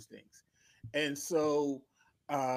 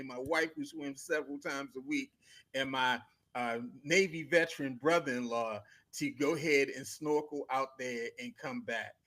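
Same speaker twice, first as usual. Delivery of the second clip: normal talking voice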